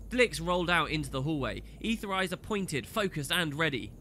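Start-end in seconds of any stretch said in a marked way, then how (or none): none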